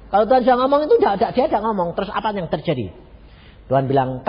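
ordinary talk

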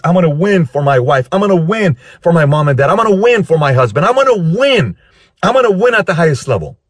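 high, impassioned vow; emotional determination. Retold or imitated. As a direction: vow it fervently, pitch climbing. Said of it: "They get emotional".

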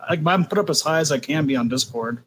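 normal talking voice

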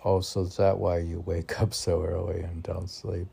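ordinary delivery

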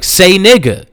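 challenging. Retold or imitated. Colin challenging Miles in an argument, insistent. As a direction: press the challenge hard.

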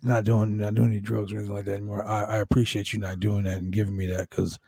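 low, earnest, halting impression; low-voiced wrestler confiding; Jake the Snake Roberts. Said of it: "he's like".

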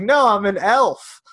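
slow, loud, hammed-up elf voice; mock fantasy voice. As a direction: drag out a silly elf line, mocking it. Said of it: "racist accents".

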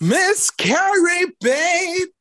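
high, slow drawn-out showgirl call; drawn-out high announcer voice for Miss Carrie Baby; character announcement. Pretend. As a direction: sing out the name like a stage host. Announcing drag character name.

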